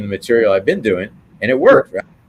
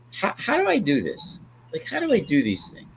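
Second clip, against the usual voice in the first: musing to himself; 7 semitones higher, 6 dB quieter than usual. Retold or imitated. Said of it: "I often say to myself".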